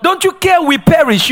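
high, pointed accusing whine; mock outrage at Jesus; accusing disciples. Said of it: "That's too deep".

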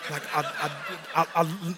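ordinary delivery

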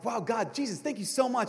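high, excited burst of gratitude; a touch of giddy delight; thrilled thanks. Retold or imitated. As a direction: gush your thanks to God. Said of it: "And I'm like".

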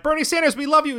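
high, gushing fan voice; adoring fans. Retold or imitated. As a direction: squeal it like a starstruck supporter. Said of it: "there's people being like".

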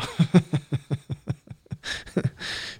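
breathy, unvoiced little laugh; airy laugh. Real, not acted. Guest thanking the host for the question, airy laugh.

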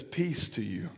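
very soft, low blessing; almost breathe the words of peace; Jesus greeting his doubter softly; hushed. Acted.

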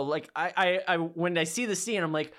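ordinary talk, nothing marked

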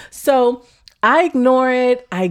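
usual speaking voice

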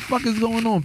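usual speaking voice

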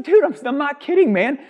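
high, loud earnest insistence; insisting. Retold or imitated. Telling his mentor he's not joking, in a high insistent voice. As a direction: push hard, swear you mean it.

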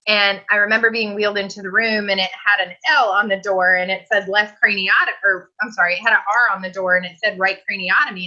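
normal talking voice

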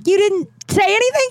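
mock-shocked squeal. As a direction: replay your own disbelief. Retelling her own shocked reaction.

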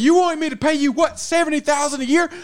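mock worker negotiating. Worker arguing pay with the boss, high mock exasperation. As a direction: argue the salary in a squeaky exasperated voice. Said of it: "It's like".

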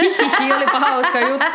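high, loud mock giggle; amused giggle. Carefree cook after dropping an egg, giggling. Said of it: "mua naurattaa".

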